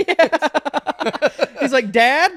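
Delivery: high and loud through laughter; laughing. Real, not acted. Friend quoting the movie son while laughing hard. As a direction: laugh while squeaking out the line.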